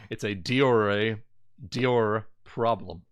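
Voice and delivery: ordinary talk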